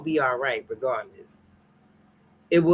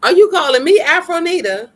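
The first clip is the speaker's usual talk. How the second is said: much higher and louder, outraged laugh in it; loud shocked squeal; shocked disbelief; 12 semitones higher, 11 dB louder than usual. Real, not acted. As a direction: shriek it in amused outrage. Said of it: "You talking on this line".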